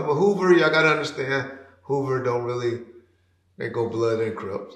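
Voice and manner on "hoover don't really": normal talking voice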